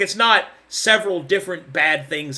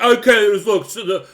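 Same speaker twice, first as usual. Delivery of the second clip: mocking bored player; 2 semitones higher, 4 dB louder than usual. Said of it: "everybody's like".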